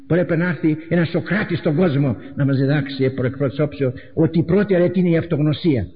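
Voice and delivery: ordinary talk, nothing marked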